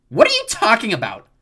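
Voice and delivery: high, loud, indignant question; angry demand. A narrator's reading. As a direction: demand an answer, outraged. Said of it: "The nymphs muttered angrily".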